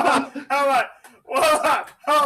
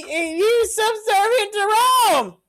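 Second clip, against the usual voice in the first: exasperated shout; 10 semitones higher, 4 dB louder than usual. Real, not acted. Exasperated point in argument.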